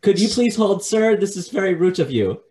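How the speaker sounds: high loud haughty voice; haughty receptionist. Retold or imitated. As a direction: put the customer in his place. Receptionist scolding customer.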